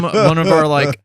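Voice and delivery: normal talking voice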